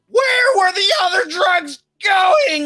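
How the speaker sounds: very high, loud, drawn-out shout; put-on hero voice. Retelling a hero trying out his new voice, high put-on shout. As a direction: belt it out slowly in a high, strained voice. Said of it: "coming up with his voice".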